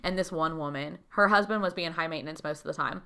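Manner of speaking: usual speaking voice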